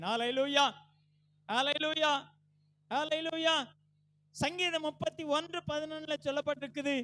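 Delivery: loud, high pulpit cry; full-force preaching. Real, not acted. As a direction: preach it out with each phrase driven hard.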